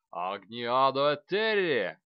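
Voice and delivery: loud, slow spell cry; shouted spell. A narrator's reading. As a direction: hurl the incantation out loud. Casting a fire-blocking spell.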